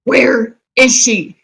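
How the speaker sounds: loud, slow goblin yell. Acted. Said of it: "in my goblin voice".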